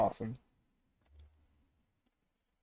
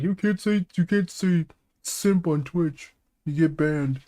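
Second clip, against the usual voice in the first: higher, slow mock official voice; imitating the platform; 3 semitones higher, slower than usual. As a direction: parody a stiff rulebook warning. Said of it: "so Switch is like".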